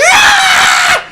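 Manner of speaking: very high shrieking scream; horrified scream. Acted. Passerby screams at the feeding vampire, shrill shriek. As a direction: let out a bystander's shriek of horror. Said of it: "she's just like".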